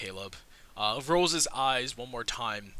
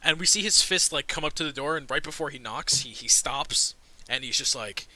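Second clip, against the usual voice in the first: normal talking voice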